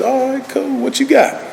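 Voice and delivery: very high, casual bargaining voice; breezy God. Retold or imitated. God answering the desperate alcoholic, breezy. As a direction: sing it out high, easy and unbothered.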